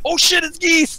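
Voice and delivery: very high-pitched mock freakout; excited fan reaction. Retelling J D C R's reaction to the reveal, excited squeal. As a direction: imitate a fan popping off, voice leaping high. Imitating a player popping at reveal.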